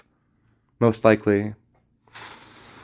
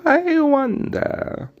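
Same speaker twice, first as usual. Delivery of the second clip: very high, slow dreamy wonder; wizard impression; much slower than usual. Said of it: "who's like".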